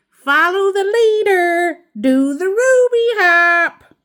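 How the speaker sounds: cheerful call out. A narrator's reading. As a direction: lead the class game loudly. Teacher calls out to class.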